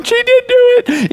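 squeaky high tearful whine; mock crying child. Little sister crying in the seat in front, mock wail. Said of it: "Kenzie's crying in the".

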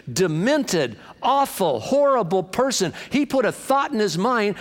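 high, forceful preacher's declaration; fired-up preaching. Real, not acted. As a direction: preach it like a fighting vow. Preaches at full force against devil.

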